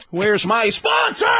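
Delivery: slow, high shouted line; Sam's scream. Shown as a demonstration. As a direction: yell it in a stretched, odd cadence. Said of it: "that voice where you go".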